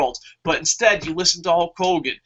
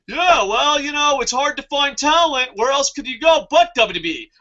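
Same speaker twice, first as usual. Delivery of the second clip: higher, mock-shouted CEO excuse; mocking Dixie Carter; 7 semitones higher than usual. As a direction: parody her smug excuse, pitched up. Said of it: "Dixie Carter's like".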